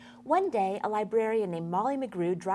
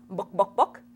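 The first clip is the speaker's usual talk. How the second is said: chicken voice; 7 dB louder than usual. Shown as a demonstration. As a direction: cluck "Book, Book" like a hen, rising. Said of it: "or should I say".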